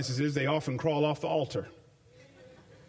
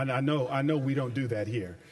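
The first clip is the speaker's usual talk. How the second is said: wry repeat dropped lower, "I know we don't do that here"; dry ironic aside, pitched lower; 5 semitones lower than usual. Shown as a demonstration. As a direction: say it again under your breath, tongue in cheek. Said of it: "I know we don't do that here in California".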